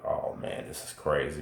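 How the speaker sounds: soft secret whisper in the dark; whispered to himself. Retold or imitated. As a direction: whisper so the cellmate won't hear. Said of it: "I'm like".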